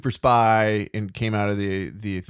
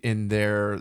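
ordinary talk, nothing marked

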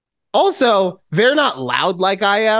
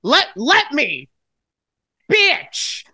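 high-pitched exasperated yelling; 9 semitones higher than usual. Acted. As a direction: yell it in mock frustration. Mock frustration at failed compliment.

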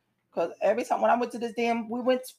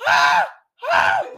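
very high, loud mock shriek; imitated screaming; 8 dB louder than usual. Imitating a friend on the slide, panicked screaming. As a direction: shriek like a terrified rider. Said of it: "when I hear her scream".

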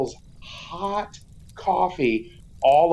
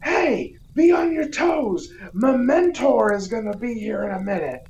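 high-pitched comic warning; guy in Booster costume; 5 semitones higher than usual. Retold or imitated. Costumed guy warning of Mementor's arrival, high mock alert. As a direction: call out a warning in a high character voice. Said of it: "the guy in the Booster costume's like".

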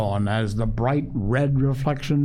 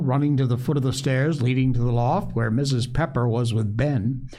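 plain reading voice

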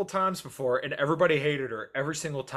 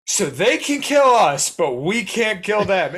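loud, slow, higher mock Trump; Trump impression; 4 semitones higher, 8 dB louder, slower than usual. Host quoting Trump at the debate, loud slow mock Trump. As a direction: declaim it slowly like a mock Trump. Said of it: "and he says".

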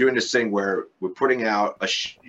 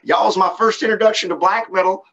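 put-on Southern accent; 11 semitones higher, 7 dB louder than usual. Bandmate retelling a fan's post-gig greeting, in a Southern accent. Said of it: "got the Southern accent and everything".

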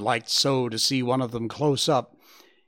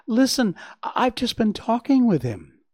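high, stammering excitement; excited fawn; 9 semitones higher, 4 dB louder than usual. Acted. Bambi runs to tell his mother, excited. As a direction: burst out with big news, high-pitched.

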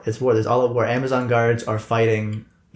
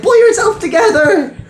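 high squeaky voice; 8 dB louder than usual. Acted. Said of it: "He's like".

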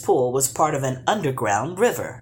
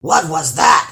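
yelping troll; 9 dB louder than usual. Acted. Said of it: "yelped the troll".

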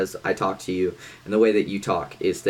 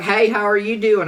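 higher, louder country greeting; a friendly country drawl; country accent; 11 semitones higher, 5 dB louder than usual. Retold or imitated. A country local greeting him in a country accent. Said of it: "they'll be like".

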